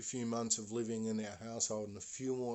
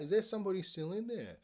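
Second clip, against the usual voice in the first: high, loud, fast call into the fire; retold yell; 9 semitones higher, 6 dB louder, faster than usual. A neighbour calls urgently toward the burning house, yelling. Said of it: "someone yells out".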